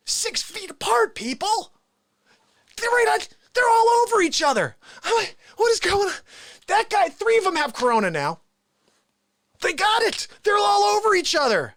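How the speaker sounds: high-pitched comic alarm at a crowd; alarmed disbelief. Retold or imitated. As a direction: protest in a high, appalled voice. Acted alarm at crowded store.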